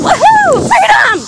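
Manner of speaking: loud, very high cheer; excited whoop. Real, not acted. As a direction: whoop it out like you just broke free. Whooping as she runs outside.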